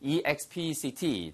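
slow, letter by letter, quiet; E X P E C T read slowly one letter at a time; spelled out. Shown as a demonstration. Teacher spelling the word expect for learners. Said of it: "spelling out expect".

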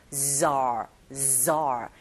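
"zar" with a long bee-like z before the vowel; buzzing z. Shown as a demonstration. Teacher drills the syllable, "zar" with buzzed z. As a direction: draw out the voiced z in "zar". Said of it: "벌이 날아갈 때 들리는 소리처럼".

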